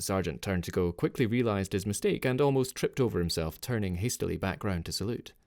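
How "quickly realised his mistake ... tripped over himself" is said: ordinary delivery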